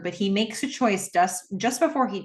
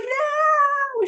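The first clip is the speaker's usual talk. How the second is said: put-on a very high, louder child's "No"; anguished child's cry; 4 dB louder than usual. Daughter crying out as her father runs out the door, very high cry. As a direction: cry out as he leaves. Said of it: "She's like".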